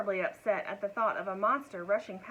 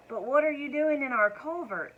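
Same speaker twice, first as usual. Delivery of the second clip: curious cow; 5 semitones higher, 6 dB louder than usual. A narrator's reading. Cow asks the dragon.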